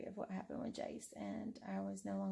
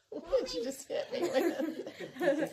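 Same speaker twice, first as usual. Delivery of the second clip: laughing; 8 semitones higher, 10 dB louder, much slower than usual. Real, not acted. Laughing after being hit.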